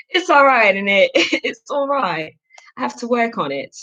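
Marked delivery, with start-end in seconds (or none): 0.1-2.3 higher, giggly British "innit"; British accent. Pretend. Self-conscious about her accent, still in a giggly British accent. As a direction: laugh through a put-on British accent. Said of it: "my little accent that I put on".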